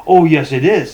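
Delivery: loud, imitated contradiction; emphatic retort. Moderator contradicting a customer about gadgets, loud retort. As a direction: hit it hard as a mock comeback.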